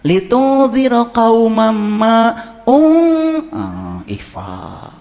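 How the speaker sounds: slow, drawn-out garbled Quran reading, "Litung ziro kaumam"; "Litung" sung high and slow, like a bad reciter; mock wrong recitation. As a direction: parody a stiff tajwid reciter. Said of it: "Salah bacanya, masuk neraka".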